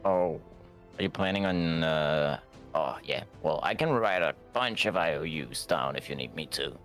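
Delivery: ordinary delivery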